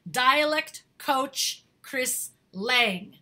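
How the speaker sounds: very slow, word-by-word repetition; each word drawn out for the note-takers; slow and deliberate. Real, not acted. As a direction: spell the name out word by word, unhurried. Repeats the name for note-takers.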